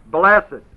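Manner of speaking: "blessed" punched loud, falling; stress on blessed. Shown as a demonstration. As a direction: hit "blessed" hard. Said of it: "you'd emphasize the word blessed".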